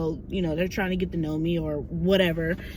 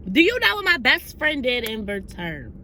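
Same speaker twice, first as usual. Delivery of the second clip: ordinary talk, nothing marked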